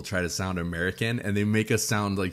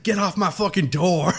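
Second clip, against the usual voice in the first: high, loud mock tough-girl bark; hammed-up tough woman; tough pimp voice; 7 semitones higher, 6 dB louder than usual. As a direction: snap it like a stone cold pimp.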